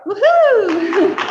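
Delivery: high, loud whoop breaking into a laugh; celebratory cheer. Real, not acted. Host cheering the program's tenth anniversary, celebratory whoop. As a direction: whoop it out for the crowd.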